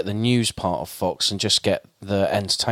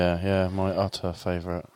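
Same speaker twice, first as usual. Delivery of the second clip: dry sarcastic deadpan, softer; 4 semitones lower, 7 dB quieter than usual. Real, not acted. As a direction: say it with heavy sarcasm. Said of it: "lack of sarcasm in my voice".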